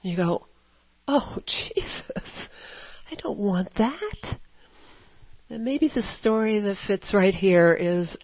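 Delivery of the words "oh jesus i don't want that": higher-pitched, pleading mock dismay; drawn-out, high "oh" of dismay; dismayed plea. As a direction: act out a pained little prayer. Acted dismayed prayer on catching oneself.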